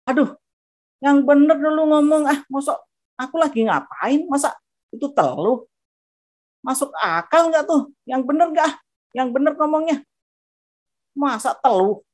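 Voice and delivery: high-pitched disbelieving snap back; mouthy retort. Retold or imitated. Retelling her reply to Evi, a high mouthy retort. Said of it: "saya nyolot-nyolot gitu kayaknya".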